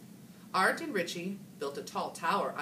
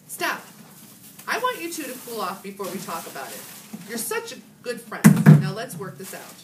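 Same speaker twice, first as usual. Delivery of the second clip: firm teacher; 5 semitones higher, 12 dB louder than usual. A narrator's reading. Teacher stopping fight.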